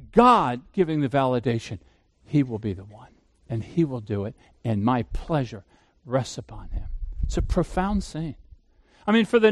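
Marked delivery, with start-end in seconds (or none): 2.2-6.9 low, soft, hushed words of God. Retold or imitated. Preacher softly voicing God's pleasure over Jesus, hushed. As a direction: let God's blessing settle quietly.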